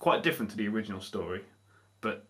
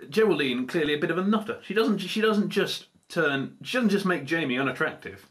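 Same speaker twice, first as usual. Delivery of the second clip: ordinary talk, nothing marked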